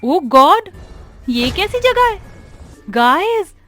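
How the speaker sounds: high-pitched startled dismay; shocked exclamation. Acted. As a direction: blurt it out in alarm at a creepy place. Friends shocked inside haunted maze. (चौंकी हुई, ऊँची आवाज़)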